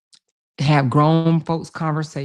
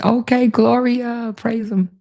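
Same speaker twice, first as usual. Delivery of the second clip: excited; 8 semitones higher, 4 dB louder, slower than usual. Real, not acted. Cheering a sick viewer on.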